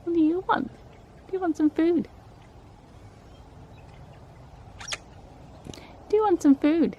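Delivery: high, sing-song call to birds; coaxing a wild bird. Real, not acted. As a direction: coax the birds in sweetly.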